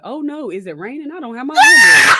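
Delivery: high, loud mock-panicked cry; alarmed coworker. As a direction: squeal in hammed-up alarm. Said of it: "she rebutted".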